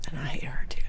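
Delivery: whispered, breathy confession; conspiratorial whisper; whispered aside. Real, not acted. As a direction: say it under your breath. Aside after the story.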